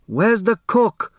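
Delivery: high, slow call for the cook; a lightly voiced older man calling out; Hu shouting. A narrator's reading. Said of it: "Mister Hu shouted".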